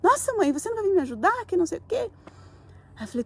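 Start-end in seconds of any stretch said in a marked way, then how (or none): 0.0-2.1 higher, imitating a cross daughter; mock the daughter's irritated whine, pitched up; angry daughter's complaint. Mother quotes her angry daughter asking why she won't help, in a high mock whine. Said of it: "a Laura bem brava".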